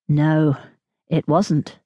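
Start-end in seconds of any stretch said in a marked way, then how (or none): none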